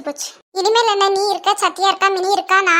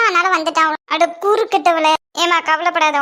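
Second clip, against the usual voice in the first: usual speaking voice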